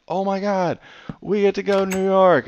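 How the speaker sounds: high, loud mock delight; excited family. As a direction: kids thrilled about the trip. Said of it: "Marge and the kids are like".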